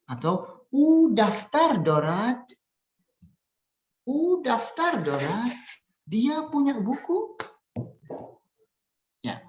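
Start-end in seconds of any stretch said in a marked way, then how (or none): 0.7-7.3 slow, very high, rising on dorat and buku; question intonation. Shown as a demonstration. Teacher models question and translation, high rising intonation. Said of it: "itu adalah intonasi bertanyanya".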